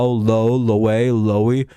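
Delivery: Lowe then Lowy, slow, testing each reading; drawn-out Lowy with a rising end; trying pronunciations. Shown as a demonstration. Streamer tries out ways to say the name, slow and unsure. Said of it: "so it could be Lau".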